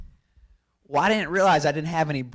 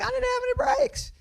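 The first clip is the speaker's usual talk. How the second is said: much higher, whiny mock plea; squeaky helpless excuse. Driver answering the furious crew chief, squeaky and meek.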